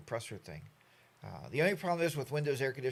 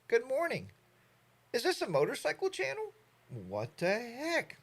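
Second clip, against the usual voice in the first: mock high voice; 10 semitones higher than usual. Reading a viewer's teasing comment.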